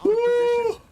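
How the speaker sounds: high, loud whoop of joy; excited cheer. Real, not acted. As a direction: cheer it out like a fan. Cheering a play on stream.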